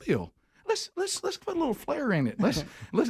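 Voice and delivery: high-pitched coaxing voice; imitated assistant coach. Assistant coach urging his boss to add flair, high coaxing. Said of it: "Coach Mann be like".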